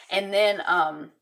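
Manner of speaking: ordinary talk, nothing marked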